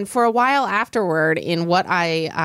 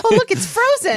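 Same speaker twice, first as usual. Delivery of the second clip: excited; 14 semitones higher, 4 dB louder than usual. Real, not acted. Excited at frozen food.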